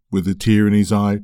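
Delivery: ordinary delivery, nothing marked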